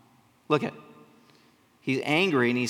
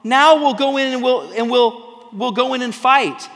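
louder and higher, an eager mock reversal; hasty change of heart; 6 semitones higher, 7 dB louder than usual. Israelites rushing to fight after all, eager and loud. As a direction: play the people suddenly keen to fight. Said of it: "and they say".

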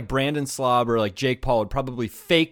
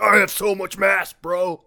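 gym bro boasting, slightly higher; frat bro; 4 semitones higher than usual. Pretend. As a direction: puff up like a proud gym bro. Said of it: "they're like".

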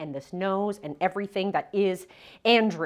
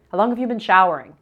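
loud demanding; 11 dB louder, faster than usual. Retold or imitated. Ex barges into bathroom.

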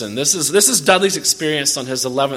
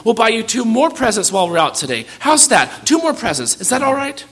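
fast, higher, anxious placating mother; a mother hurriedly bargaining with her son, fast and higher; said quickly; 8 semitones higher, 5 dB louder than usual. Acted. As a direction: rush the offer out to stop the tantrum. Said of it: "she said quickly".

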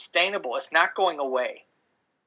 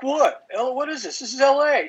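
higher, defensive disbelief; baffled; 8 semitones higher than usual. Retold or imitated. Retelling to a cohost how he answered his laughing wife, baffled and higher. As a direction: pitch it up like you can't believe she's laughing.

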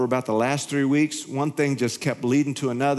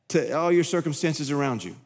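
normal talking voice

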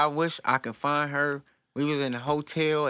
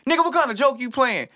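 higher, mock suspicious anger; hammed-up angry father, higher; mock angry dad; 10 semitones higher, 3 dB louder than usual. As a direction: play the dad who thinks it's a prank. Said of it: "he was angry".

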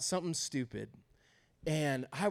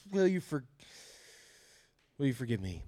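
reluctant apology; 4 semitones lower than usual. Acted. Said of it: "every single time those words are about to come out of my mouth".

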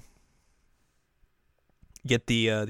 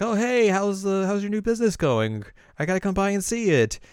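lightly voiced bubbly woman; high friendly voice; 9 semitones higher, 4 dB louder than usual. Erika is like, high voice.

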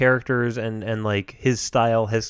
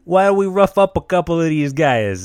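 louder wiseguy Jersey accent, bada bing; a hammy New Jersey mobster pitching a job to Yogi; Jersey wiseguy asking Yogi to rough some guys up; 4 semitones higher, 4 dB louder than usual. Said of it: "a different celebrity impression".

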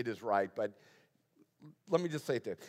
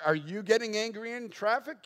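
higher-pitched, rising acted question; mock challenging question; 9 semitones higher, 4 dB louder than usual. Imagined skeptic quizzing the preacher about traffic, mock challenging question.

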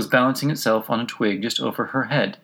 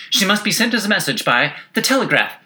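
high passenger voice; 10 semitones higher, 4 dB louder than usual. A narrator's reading.

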